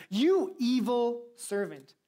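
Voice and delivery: loud, slow royal rebuke; angry king. Acted. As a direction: thunder it down at the servant. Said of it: "Then the angry king".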